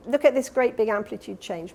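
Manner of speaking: ordinary delivery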